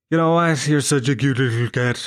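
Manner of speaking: put-on gooey pet baby talk; cat-cooing voice. Sap forgiving his cat, soppy cooing baby talk. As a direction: coo soppily at a beloved pet. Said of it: "talking to my cat like that".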